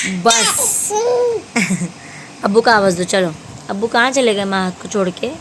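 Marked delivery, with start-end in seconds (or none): 0.1-2.4 laughing. Real, not acted. Mother laughing with baby.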